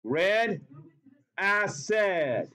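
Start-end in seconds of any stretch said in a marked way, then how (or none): 1.4-2.5 "acid" with a long "-ed" second syllable; acid rhymed with red. Shown as a demonstration. Teacher proving the rhyme to the class, "acid" said as ass-ed. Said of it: "Yes, it does rhyme".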